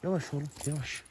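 high, soft soothing coo to calm a horse. Real, not acted. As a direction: gently settle an anxious horse. (yumuşak, yatıştırıcı mırıltı)

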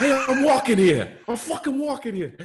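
loud, high hammed-up New York street yell; mock New York accent. Laughing, a high loud New York yell at a car. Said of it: "How do I do a New York accent?".

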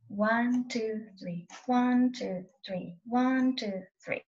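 slow, even count of one and two; counting the beat. Shown as a demonstration. Violin teacher counting vibrato sets for a student, steady. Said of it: "a set of three".

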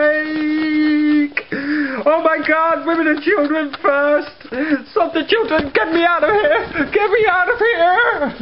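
high hammed-up screaming panic; hammed-up fake earthquake terror; mock panic. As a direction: overact a disaster victim fleeing. Mock panic, bored play-acting.

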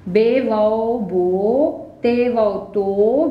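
बो and तो with a long, wide vowel; slowly drawn बो, तो; slow spelling demo. Shown as a demonstration. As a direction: stretch the vowel in बो and तो. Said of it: "फैल कर लंबा होकर पढ़ा".

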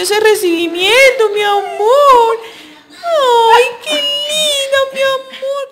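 high, loud, gushing pet voice; baby talk to dog. Acted. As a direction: coo over the puppy as if she were a baby. Greeting her dog Abby.

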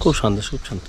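soft, hushed repeat. Real, not acted. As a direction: say it softly, in awe. Echoing the calm of monastery.

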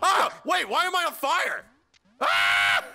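high, loud panicked yells; panicked yelling. Real, not acted. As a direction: panic on catching fire. On fire in game.

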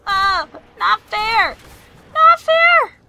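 high protest building to a yell; whiny protest. Real, not acted. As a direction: holler like it's totally unfair.

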